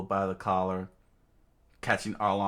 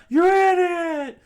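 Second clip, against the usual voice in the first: very high, very loud shout; crowd shout; 11 dB louder than usual. Acted. Crowd screams at the villain as the hero dives, very loud high shout. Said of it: "the whole crowd screamed out".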